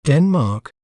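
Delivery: Denmark said carefully on its own, falling at the end; pronunciation demo. Shown as a demonstration. Teacher showing viewers how to say the name, careful demonstration of Denmark. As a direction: model the word Denmark clearly, stress on the first syllable. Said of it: "We may pronounce it as".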